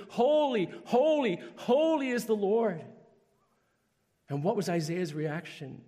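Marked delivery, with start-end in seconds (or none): 0.1-2.8 high, loud proclaiming cry; crying out holy. Retold or imitated. Seraphim in Isaiah's vision crying out, loud and high. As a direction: call it out like angels across heaven. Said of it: "seraphim were crying out".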